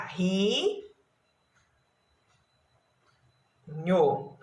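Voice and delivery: ri drawn out, then nho after a long pause; syllables separated. Shown as a demonstration. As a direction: dictate ri... nho as isolated syllables. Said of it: "ela tem que ser separada".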